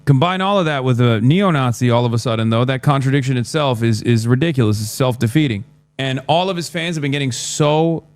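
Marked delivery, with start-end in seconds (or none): none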